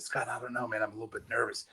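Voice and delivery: softly, timid and unsure; a nervous new guy, barely audible; quiet hesitant reply; nervous hush. Retold or imitated. Nervous new hire answers boss.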